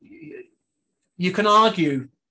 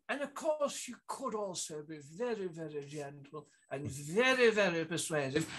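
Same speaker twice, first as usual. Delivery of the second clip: soft, coaxing Thatcher voice; gentle persuasive Thatcher; 9 dB quieter than usual. Retold or imitated. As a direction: purr it out like Thatcher winning over the cabinet. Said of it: "the way Missus Thatcher dealt with the cabinet".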